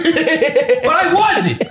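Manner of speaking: slow high laughing but I wasn't; laughing mock confession. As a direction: stretch it out, breaking into laughter. Mock regretful dad confession.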